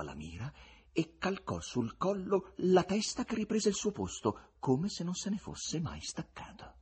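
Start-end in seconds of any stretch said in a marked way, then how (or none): none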